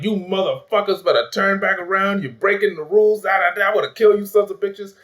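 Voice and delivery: high-pitched mock angry rant; Amanda Waller snapping. Movie boss threatening her disobedient team, imitated angry snapping.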